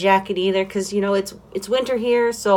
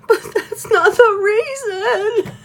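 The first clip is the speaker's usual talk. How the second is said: laughing protest; 13 semitones higher, slower than usual. Retold or imitated. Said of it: "I'm sitting here going".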